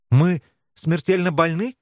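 faltering voice. A narrator's reading. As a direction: falter on the question. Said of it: "дрогнувшим голосом спросила Вика". (дрогнувшим голосом)

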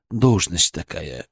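low, slow, understated cut-off; curt retort. A narrator's reading. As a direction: close the subject quietly and firmly. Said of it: "отрезал Киселёв".

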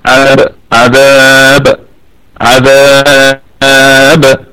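عذاب with its ا held very long; pause with long madd. Shown as a demonstration. Teacher demonstrates the long pause on عذاب, slow. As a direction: hold the ا of عذاب extremely long. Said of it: "أما وقفًا ففيها ثلاثة".

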